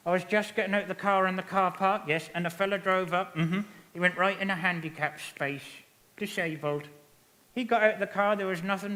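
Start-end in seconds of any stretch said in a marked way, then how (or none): none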